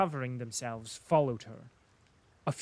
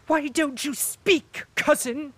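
high, loud, slow shriek at her cousin; sudden shriek; 13 semitones higher, 8 dB louder than usual. A narrator's reading. Princess shrieks at the silent prince, high and loud. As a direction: shriek it out, higher and louder. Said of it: "suddenly shrieked the princess".

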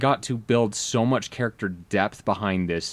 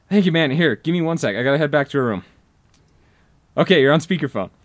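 higher, louder apologetic friend; player voice; 5 semitones higher, 5 dB louder, faster than usual. Retold or imitated. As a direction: sheepishly ask a late favor.

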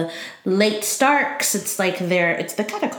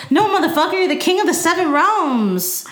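laughing mock insistence; 8 semitones higher than usual.

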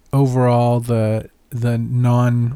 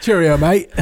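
laughing mock British; 4 semitones higher, 3 dB louder than usual. Joining the British joke, laughing.

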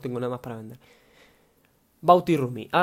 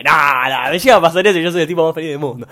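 thrilled and much louder; excited outburst; 2 semitones higher, 11 dB louder than usual. Real, not acted. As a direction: blurt it out loudly, overjoyed at the thought. Thrilled at imagined podcast fame.

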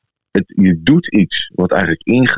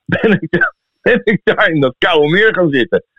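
laughing; 10 semitones higher than usual. Real, not acted. Laughing while telling cold lake dip.

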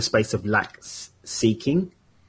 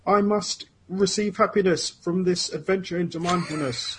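theatrical Shakespearean declaiming, raised pitch; grand tragic wailing; 9 semitones higher than usual. Acted. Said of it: "You sound like a Shakespearean actor".

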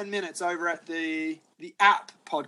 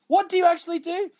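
high-pitched retold disbelief; mock exasperation; 11 semitones higher than usual. Customer to bartender after every cocktail is refused, mock exasperation. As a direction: act out a baffled, fed-up customer.

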